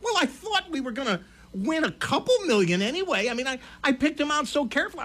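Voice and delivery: lightly voiced wife, defending herself; imitated protesting wife.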